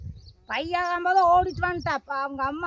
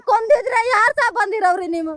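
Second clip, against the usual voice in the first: real sobbing outburst; crying shout. Real, not acted. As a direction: wail the question through tears. Woman cries out at official. (ಅಳುತ್ತಾ ಜೋರಾಗಿ ಕೇಳು)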